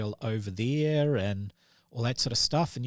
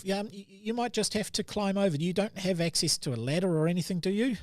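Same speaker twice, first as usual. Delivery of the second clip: elf voice; 7 semitones higher, faster than usual. Acted.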